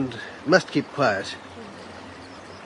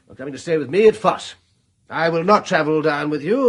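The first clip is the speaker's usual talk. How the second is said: usual speaking voice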